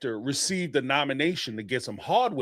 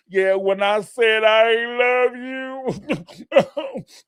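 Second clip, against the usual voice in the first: slow, higher fake sob; mock fake crying; 8 semitones higher, 4 dB louder, much slower than usual. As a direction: drag it out like forced tears. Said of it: "squeeze out some".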